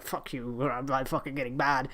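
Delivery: low, quiet growling grumble; mock Vince. Imitating Vince getting mad at McAfee, low and muttered. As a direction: grumble like a cranky old boss. Vince voiced angry in retelling.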